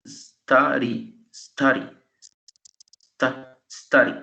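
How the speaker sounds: "study" split into two beats, stu-dy; stu-dy, sounded out to count syllables. Shown as a demonstration. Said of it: "Este tiene dos".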